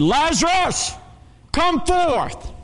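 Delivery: high, drawn-out summons; calling by name. Acted. Jesus at the tomb calling dead Lazarus out, high ringing call. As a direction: call him out high, as across a graveyard. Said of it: "I had to call Lazarus specifically by name".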